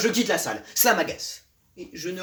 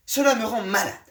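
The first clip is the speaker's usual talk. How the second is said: ordinary delivery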